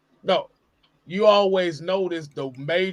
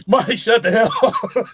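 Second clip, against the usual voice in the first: laughing; 7 semitones higher, 7 dB louder than usual. Real, not acted. Laughing rebuke.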